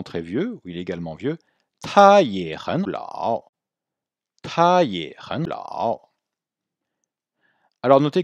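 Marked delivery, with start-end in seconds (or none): 1.8-3.5 他也很老 drawn out, louder, full tones; careful syllable-by-syllable Mandarin demo; slow model phrase. Shown as a demonstration. Teacher models new example slowly. 4.4-6.1 他也很老 drawn out with full tones; very slow Mandarin repeat drill; slow model phrase. Shown as a demonstration. Teacher repeats model for students.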